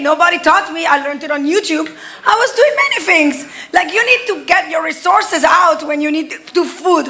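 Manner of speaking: usual speaking voice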